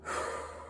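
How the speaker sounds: soft, voiceless exhale; deep breath sigh. Shown as a demonstration. Reader leading listeners to let go, deep sighing breath. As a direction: breathe out long to show letting go. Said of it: "Take a deep breath".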